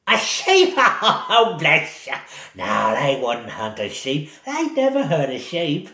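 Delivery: put-on old lady voice, laughing off the question; amused old woman. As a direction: chuckle and brush it aside like a kindly granny.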